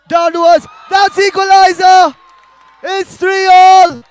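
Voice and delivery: high, loud roar of a goal call; stretched, yelled "three all"; equaliser shout. Real, not acted. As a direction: scream the equaliser as it hits the net. Commentator calls the equaliser.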